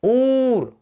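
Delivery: ऊर with the ऊ drawn out long and high. Shown as a demonstration. Teacher showing students the long ऊ sound. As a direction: hold the ऊ in ऊर. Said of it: "उच्चारण लंबा करो".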